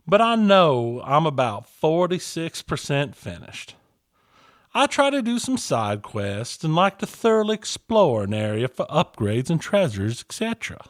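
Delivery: even, steady reading of a letter; listener email read. Acted. Reads listener email aloud.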